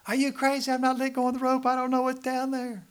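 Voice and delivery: high, loud acted protest; panicked refusal. Man hanging on the rope answers the voice, in loud high protest. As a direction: yell back up in a high, alarmed voice.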